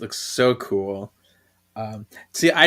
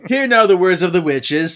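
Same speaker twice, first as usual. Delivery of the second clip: higher, grand opening proclamation; ceremonial announcement; 6 semitones higher, 6 dB louder than usual. Acted. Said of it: "I haven't done that in a while".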